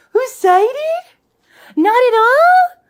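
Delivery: high, hyped-up pet-parent voice; drawn-out high squeal to rile up a dog; excited dog talk. Pretend. Teasing an unresponsive dog.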